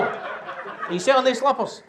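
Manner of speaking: ordinary talk, nothing marked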